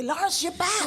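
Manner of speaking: high, excited mock call; retold excitement. Host retells her phone call to Lawrence, acted excitement. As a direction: re-enact an excited welcome-back, breaking into laughter. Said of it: "You could hear the excitement in his voice".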